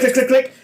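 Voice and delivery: high, loud mock clicks; clicking noises. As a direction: click it out like a ratchet. Imitating a measuring tool clicking.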